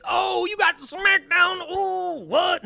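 high, drawn-out taunting hoot; mocking jeer. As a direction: hoot it slowly in a silly high voice, mimicking online hecklers. Mimics jeering Twitter replies.

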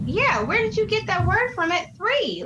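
high-pitched incredulous question; acted-out astonishment; mock disbelief. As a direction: playfully quiz a toddler in amazement. Acting out astonished question to toddler.

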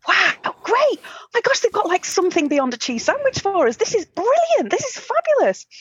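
high, gushing delight; imitating a thrilled teenager; overexcited. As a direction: squeal with joy at the menu. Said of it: "my brother and I are like".

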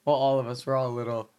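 ordinary delivery

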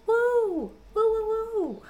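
squeaky high woo; high cheer. Real, not acted. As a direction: cheer them on. Cheering patrons.

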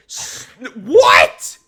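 high, loud indignant what; outraged disbelief. Real, not acted. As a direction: sputter in disbelief. Outraged at fortune cookie.

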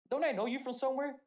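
high, loud voice for the sergeant; sergeant's question. Retold or imitated. Sergeant spotting her old recruit in a restaurant, high and loud. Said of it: "She looked at me".

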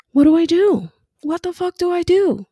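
high, loud desperate what do I do; exasperated cry. Retold or imitated. New mom venting her confusion, high loud and desperate.

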